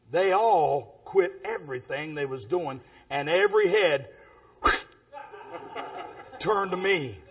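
normal talking voice

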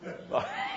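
sudden high laugh; high burst of laughter. Real, not acted. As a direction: break into a quick laugh. Laughing at listener.

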